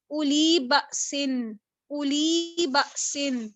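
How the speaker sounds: slow recitation of اولی بأسن, the silent waw dropped and only the hamza's damma sounded; slow Quran recitation. Shown as a demonstration. Teacher reciting اولی بأسن slowly for students, silent waw dropped. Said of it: "صرف اور صرف حمزہ کے ضمہ کو پروناؤنس کیا".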